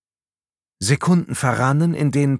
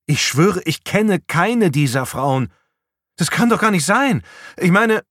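higher, louder pleading denial; desperate protest; 7 semitones higher, 4 dB louder than usual. A narrator's reading. Patrick swears innocence to the police, desperate. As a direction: insist urgently, voice rising.

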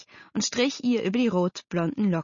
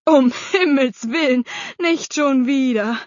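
louder, higher, dragging groan of protest; exasperated outburst; 6 semitones higher, 7 dB louder than usual. A narrator's reading. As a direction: protest loudly, fed up. Father dreads another match. (entnervter, lauter Ausruf)

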